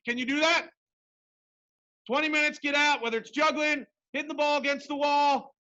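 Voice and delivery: ordinary delivery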